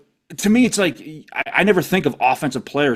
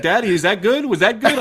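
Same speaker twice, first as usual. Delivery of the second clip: put-on little girl voice; 7 semitones higher than usual. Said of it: "she's like".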